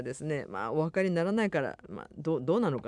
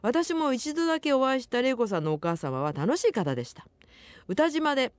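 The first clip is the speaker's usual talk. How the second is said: higher-pitched, lively radio letter reading; reading listener mail; 8 semitones higher than usual. Acted. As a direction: read the listener's message out brightly, pitched up. Reads listener's mail on air. (お便りを読み上げる高めの声)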